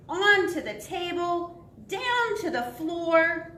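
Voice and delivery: high, emphatic picture-book read; dramatic narration. A narrator's reading. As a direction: trace the dog's escape with big energy. Dog grabbing lunch in story.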